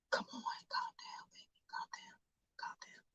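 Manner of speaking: hushed whisper to settle him down; wife whispering to calm her angry husband. Acted. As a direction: soothe your husband under your breath.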